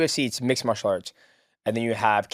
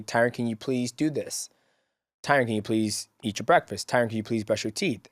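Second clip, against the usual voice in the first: patient parent; 3 semitones lower, 7 dB quieter than usual. Retold or imitated. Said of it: "I say".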